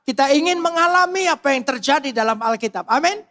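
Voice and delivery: loud, high, impassioned preaching. Acted. Preacher rousing the congregation, loud impassioned delivery. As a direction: drive it home from the pulpit. Preaching at full force opening.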